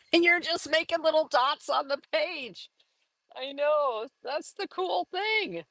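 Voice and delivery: high-pitched, tickled delivery; playful high voice on the edge of laughter; amused squeal. Acted. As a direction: squeak it out just after a laugh. Laughs voicing the puzzled viewer.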